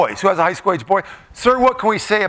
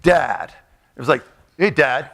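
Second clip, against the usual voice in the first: low, curt "Dad", a lightly voiced teenage boy; mock tough teen; 7 semitones lower than usual. Teenage son greeting his father, in a lowered, curt voice. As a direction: drop the voice low to sound grown up. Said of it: "made a concerted effort to say".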